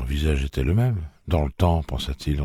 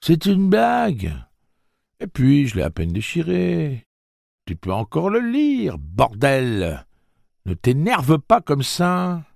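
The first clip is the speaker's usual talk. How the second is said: defensive bully; 11 semitones higher, 3 dB louder, slower than usual. A narrator's reading. Bully protests, feigning hurt.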